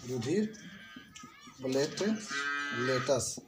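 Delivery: "रुधिर platelets" slowly, with long gaps between the parts; word-by-word dictation. Shown as a demonstration. Teacher giving pupils the third name, slow split "रुधिर platelets". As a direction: pause after "रुधिर", then split "platelets" into plate and latus.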